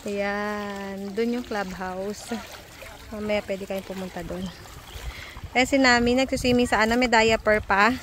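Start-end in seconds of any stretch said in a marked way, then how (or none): none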